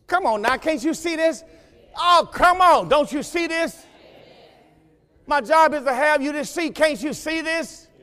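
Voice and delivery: preacher pressing the point; urgent pleading. Real, not acted. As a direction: come on, make them see it. Urging the congregation.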